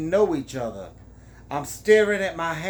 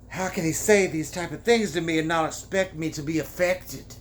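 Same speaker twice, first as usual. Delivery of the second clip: ordinary delivery, nothing marked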